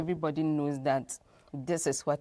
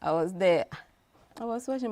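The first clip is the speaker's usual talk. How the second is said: ordinary talk, nothing marked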